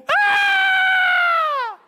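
high, loud laughing shriek; laughing shriek of alarm; panicked scream. Retold or imitated. Reaction to becoming pastor.

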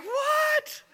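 very high, loud shocked squeal; stunned disbelief. Retold or imitated. Disbelief at free phone.

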